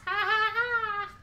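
high, acted goat cackle; mocking goat laughter. A narrator's reading. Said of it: "And they laughed at the troll".

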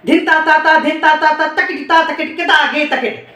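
rhythmic syllables spoken fast and even; rapid bol recitation. Shown as a demonstration. Teacher reciting drum bols for students, rapid chant. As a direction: rattle off the tabla syllables quickly in rhythm. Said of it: "बोल बोल के रियाज करना है".

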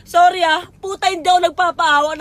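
overwhelmed apology through tears; real tearful outburst. Real, not acted. Reliving a painful breakup, crying while apologizing to the audience. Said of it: "Nadadala lang talaga ako".

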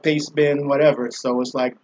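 usual speaking voice

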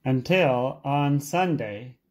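ordinary delivery

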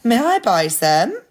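high, polite piggy question; lightly squeaky piglet asking; little pig. Acted. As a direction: ask sweetly as an eager little pig. Pig character asks, voiced.

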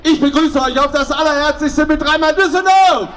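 festive shout. Real, not acted. Carnival president's festive cheer.

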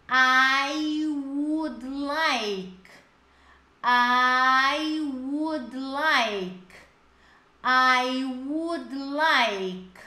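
"I would like" stretched very slowly, syllable by syllable; slow model phrase. Shown as a demonstration. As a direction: drag out each word for the class to copy. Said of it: "Então, vamos lá". ("I would like" bem lento e pausado)

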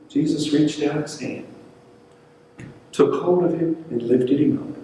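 ordinary talk, nothing marked

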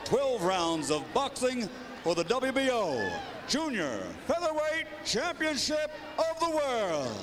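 booming ring announcer intro. Acted. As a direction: announce it big to the arena.

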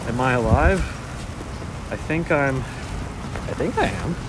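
ordinary talk, nothing marked

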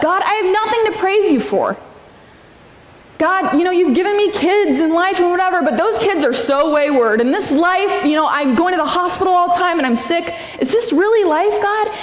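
high, whiny plea of a stuck believer; pleading complaint to God. Pretend. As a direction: complain to God, voice lifted and strained. Imagined prayer of stuck believer.

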